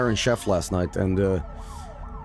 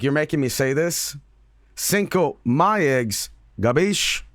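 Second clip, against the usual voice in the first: hammy Italian mobster voice; mock mobster accent; 5 semitones higher, slower than usual. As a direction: do a cheesy gangster. Mock mobster line from movie.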